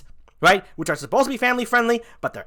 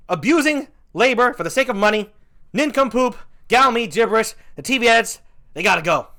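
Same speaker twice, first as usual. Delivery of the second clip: ordinary talk, nothing marked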